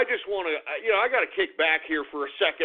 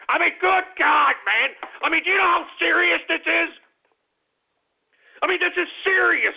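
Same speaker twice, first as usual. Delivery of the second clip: much higher and louder, ranting in disbelief; exasperated outburst; 9 semitones higher, 5 dB louder than usual. Acted. Talk host raging at listeners over coup news, loud exasperated rant. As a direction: throw the words up in outrage.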